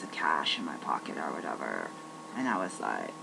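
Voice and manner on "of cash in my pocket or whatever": whisper. Real, not acted. Lowering voice about scarce cash.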